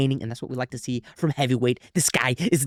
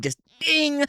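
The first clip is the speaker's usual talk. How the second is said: mimicked ding; 12 semitones higher than usual. Imitating the knockout shot.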